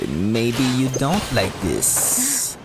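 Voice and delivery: ordinary talk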